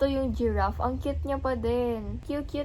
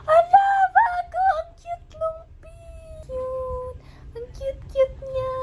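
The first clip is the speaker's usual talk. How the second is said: very high, drawn-out gushing; shriek, then coo high in adoration; delighted squeal; 13 semitones higher, 4 dB louder, slower than usual. Real, not acted. Vlogger unboxing a new pink figure, delighted squeal.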